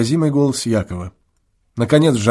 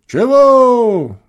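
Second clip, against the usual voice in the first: high, louder distant call back; a boy answering from the far end of the meadow, distant call; faint distant reply; 11 semitones higher, 6 dB louder than usual. A narrator's reading. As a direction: answer from far across the field. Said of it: "едва слышный ответ".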